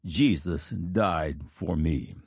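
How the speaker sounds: slow, low and hushed; slowly said. A narrator's reading. Dying preacher's last words to those present, slow and low. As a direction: let each word fall slowly and low. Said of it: "he slowly said".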